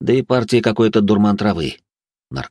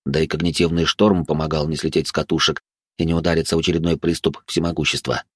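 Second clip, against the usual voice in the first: plain reading voice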